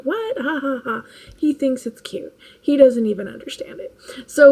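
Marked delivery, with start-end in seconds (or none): 0.0-1.0 mimicking child. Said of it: "he's been like".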